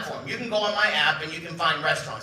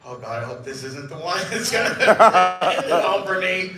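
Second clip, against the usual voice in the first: laughing through a mock politician's worry; 5 dB louder than usual. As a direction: voice the politician's fear while laughing. Politician's worried thought, laughing.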